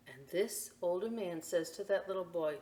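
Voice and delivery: ordinary delivery, nothing marked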